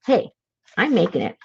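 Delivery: ordinary talk, nothing marked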